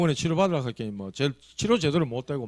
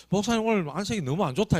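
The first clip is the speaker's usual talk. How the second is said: concerned deacon; 6 semitones higher than usual. Retold or imitated. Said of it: "이렇게 얘기했어요".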